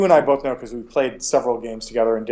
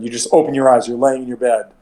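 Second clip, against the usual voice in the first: normal talking voice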